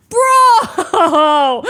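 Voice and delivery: laughing yell; shout. Retold or imitated. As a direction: whoop it out through laughter. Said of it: "and they're like".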